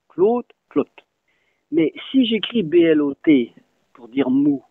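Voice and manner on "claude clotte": "Claude" with a long O, "clotte" with a short clipped O; long then short O. Shown as a demonstration. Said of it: "le O sera long ... bref". ("Claude" au o long, "clotte" au o bref)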